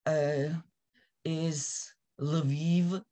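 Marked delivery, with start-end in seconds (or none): 2.2-3.0 Lviv as l-uh-VEEV, a small vowel after the L. Shown as a demonstration. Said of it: "the closest that I can think of".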